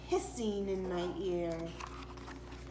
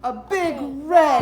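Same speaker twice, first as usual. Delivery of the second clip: spooky build; 10 dB louder, slower than usual. Acted. Scary monster reveal.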